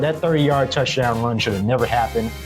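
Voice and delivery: ordinary talk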